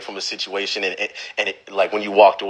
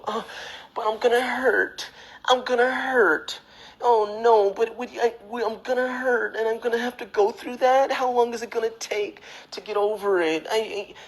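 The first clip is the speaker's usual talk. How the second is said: high-pitched, softer mock whimper; mock whining; 11 semitones higher, 4 dB quieter than usual. People afraid to leave a relationship, mock whining. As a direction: fret and whine like a hurt lover.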